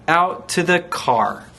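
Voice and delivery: plain reading voice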